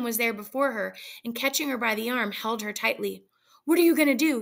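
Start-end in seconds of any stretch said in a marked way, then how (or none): none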